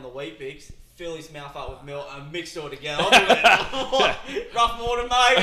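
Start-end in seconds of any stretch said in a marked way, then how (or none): 3.1-5.4 slow, higher, much louder, laughing all through; laughing impression. Imitating the Weet-Bix eater's morning greeting, laughing. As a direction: crack up while voicing the dude.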